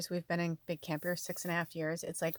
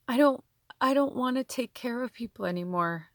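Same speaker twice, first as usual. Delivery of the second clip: higher, louder, choked-up plea; tearful insistence; 6 semitones higher, 5 dB louder than usual. Real, not acted. Telling listeners about being worn out from caretaking, tearful. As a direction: push it out through tears. Still emotional after tearful lyrics.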